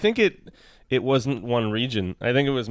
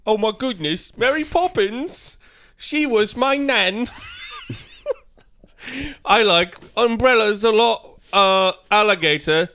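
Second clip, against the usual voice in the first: put-on terrible British accent; 8 semitones higher, 3 dB louder than usual. Reading one more card in the accent, high and hammily British. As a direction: do a bad Mary Poppins-era British voice. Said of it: "in the accent".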